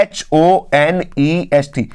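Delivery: normal talking voice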